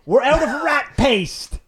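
high, loud, drawn-out mock British; hammed-up British shouting; British accent. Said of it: "best British accent".

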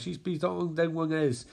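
ordinary delivery

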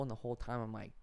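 ordinary talk, nothing marked

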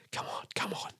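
urgent hushed whisper. Real, not acted. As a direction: whisper it, willing the phone to ring. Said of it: "I don't know why I'm whispering".

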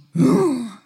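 mock gasp. Mock gasp after a complaint.

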